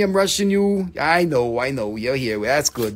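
ordinary talk

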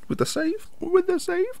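way up high and falsetto, mock upset; high squeaky voice. Gamer mocking himself in a high falsetto voice. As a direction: squeak it like you're secretly upset. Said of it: "Don't know why I said it like that".

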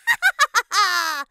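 loud evil cackle; witch cackle. Acted. Witch appears behind twins.

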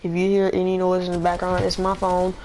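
usual speaking voice

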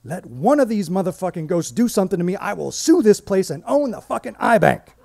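loud, high defiant rant; ranting Nancy. Retold or imitated. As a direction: dare the ghosts, fired up.

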